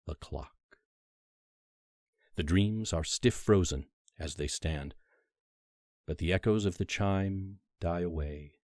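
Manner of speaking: ordinary delivery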